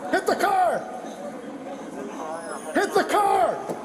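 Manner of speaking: urgent high shouted police orders; shouting commands. Real, not acted. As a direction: yell the commands at the suspects. Police stop, officers yelling commands.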